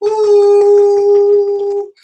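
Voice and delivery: long high loud ooh; vocal warm-up. Shown as a demonstration. Host getting everyone's vocal cords ready, long loud ooh. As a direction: hold one big high ooh. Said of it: "Get your vocal cord ready".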